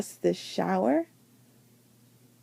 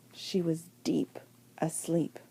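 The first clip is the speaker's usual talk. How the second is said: much softer, lower, fading; sleepy hush; 6 semitones lower, 10 dB quieter than usual. A narrator's reading. Narrator putting Abigail to sleep, hushed and low. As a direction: let it sink into sleep. Falls asleep.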